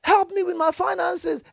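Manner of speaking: high, loud mocking plea for money; preacher mocking a stingy believer's plea. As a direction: imitate a desperate churchgoer begging. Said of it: "now you're saying".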